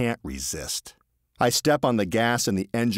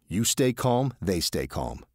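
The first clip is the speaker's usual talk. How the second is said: ordinary delivery, nothing marked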